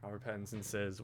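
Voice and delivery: ordinary talk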